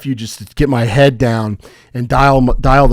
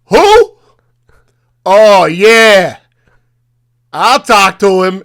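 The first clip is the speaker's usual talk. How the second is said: much higher, loud bark of a busy boss; gruff busy boss; 6 semitones higher, 8 dB louder, much slower than usual. Retold or imitated. Rick the pizza shop owner grabs the phone, loud gruff bark. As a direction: blast it like an irritated monster. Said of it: "you hear the monster in the background".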